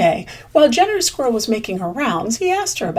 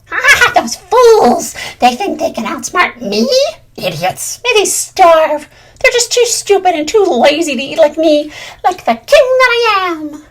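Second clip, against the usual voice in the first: high, loud gloating villain cackle; maniacal laugh; 8 semitones higher, 8 dB louder than usual. Acted. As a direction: cackle like a mad little king. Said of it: "He laughed maniacally".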